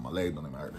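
ordinary delivery

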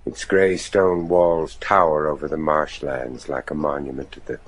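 low, measured journal reading; diary narration. A narrator's reading. Deacon recording the night in his diary, low measured narration. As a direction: read the entry in a grave low voice.

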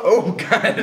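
laughing through the words. Real, not acted. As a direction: gasp it out mid-laugh. Burned by hot pie.

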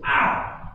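sudden loud "ow" from a bee sting; mock yelp of pain. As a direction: yelp like a dad who just got stung.

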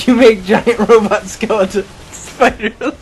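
botched punchline dissolving into laughter; cracking up. Real, not acted. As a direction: lose it laughing as you finish the joke. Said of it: "What I meant to say".

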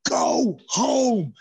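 mock yell from backstage. Crew yelling at wrestlers to end the show, mock yell. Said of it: "they were screaming in the back".

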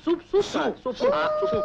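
squealing high with glee; excited. Acted. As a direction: squeal for the soup. Excited for soup.

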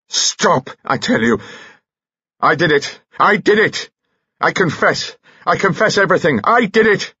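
high, desperate outburst; frantic confession. Acted. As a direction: blurt it out, pitch climbing to a near-shriek. Frantic confession in an acted confrontation.

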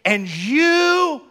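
hammed-up movie line, high and loud; Jerry Maguire. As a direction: stretch the last word into a big dramatic cue. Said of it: "and he goes".

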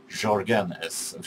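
Jorgen S said slowly with a soft zh or h sound and weight on GEN; mock Spanish pronunciation of Jorgen. Shown as a demonstration. Vlogger joking about the wrong version, Spanish-style Jorgen stressed on the end. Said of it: "not a Spanish version".

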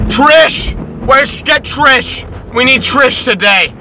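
loud high calling out across the counter; shouting a name. Retold or imitated. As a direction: call out for Trish at the top of the voice. Airport staffer shouting for colleague.

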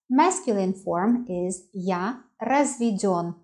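usual speaking voice

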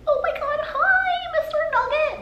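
very high-pitched gushing at a puppy; sing-song, sky-high pet talk; dog voice. Retold or imitated. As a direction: squeal a greeting as if to a dog. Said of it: "That's my dog voice".

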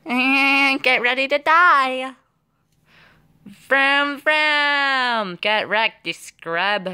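high, drawn-out silly taunt; mock battle cry. Player taunting enemies as the attack starts, mock battle cry. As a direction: stretch it out high in a playful put-on battle voice.

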